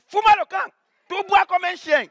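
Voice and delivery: high, loud mock shout; hammed-up furious stranger yelling get lost; angry brush-off. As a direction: bark it like a fed-up stranger chasing a kid away. Angry stranger chases him off.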